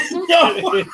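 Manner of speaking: high laughing no. Real, not acted. Laughing through the heat.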